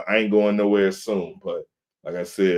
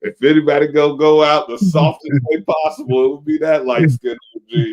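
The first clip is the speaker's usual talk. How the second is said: laughing exaggeration; 7 semitones higher, 7 dB louder than usual. Real, not acted. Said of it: "joking about himself".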